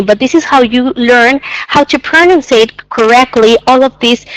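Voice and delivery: ordinary delivery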